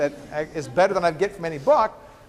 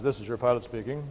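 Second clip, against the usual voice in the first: pilot announcement; 7 semitones lower, 6 dB quieter than usual. Acted. Joke pilot's cabin announcement.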